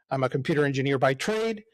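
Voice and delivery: ordinary delivery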